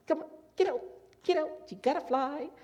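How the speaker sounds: high, prodding mother voice; high coaxing mom voice; mother bird nudging. Retold or imitated. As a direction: chivvy the chick out of the nest, pitched high. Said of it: "mom's there with him ... she's poking at him".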